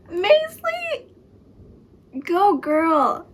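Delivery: high, loud, delighted shout; excited cheer. Real, not acted. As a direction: cheer her on at full voice. Cheering a character who returns.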